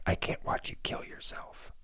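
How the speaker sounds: hushed tearful whisper. Pretend. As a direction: breathe it out like heartbreak. Son quote ends in whisper.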